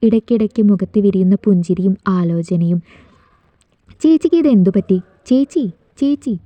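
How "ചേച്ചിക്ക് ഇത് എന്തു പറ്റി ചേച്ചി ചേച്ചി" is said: calling out to sister. A narrator's reading. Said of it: "Appu അവളെ കുലുക്കി വിളിച്ചു".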